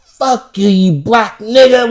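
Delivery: high, loud mock yell, slowed down; hammed-up cursing at the hero; mock villain yell. Retelling a film villain's last words, loud mock yell. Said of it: "He was like".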